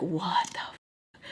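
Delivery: slow hushed disbelief; stunned whisper. Retold or imitated. Whispering in shock at the smirking woman. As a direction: breathe it out slowly. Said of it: "I was just like".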